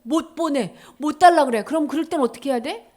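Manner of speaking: ordinary delivery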